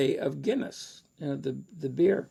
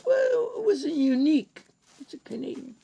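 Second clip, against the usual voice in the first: high, dry Irish-tinged put-down; Ken's wry verdict; 13 semitones higher than usual. Retold or imitated. Ken teasing the nervous Canadian reader after his flop. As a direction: deliver the polite jab high and arch. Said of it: "Ken said".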